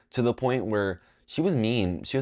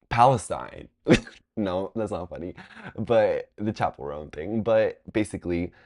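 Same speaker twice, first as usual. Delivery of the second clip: ordinary talk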